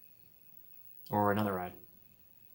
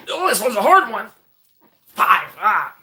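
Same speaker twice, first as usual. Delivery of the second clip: strained laugh; 11 semitones higher, 6 dB louder than usual. Real, not acted. Squeezing lemons on stream.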